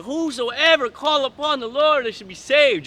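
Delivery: high, slow mocking singsong; mocking quote. Mocking the Christians who love quoting this, slow and high. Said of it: "Y'all love saying".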